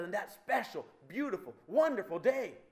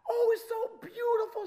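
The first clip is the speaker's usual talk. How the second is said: very high, loud mock-mother squeal; hammed-up adoring falsetto; over-the-moon gushing; 5 dB louder than usual. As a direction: gush like a besotted new mother. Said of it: "over the moon".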